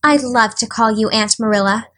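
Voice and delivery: ordinary delivery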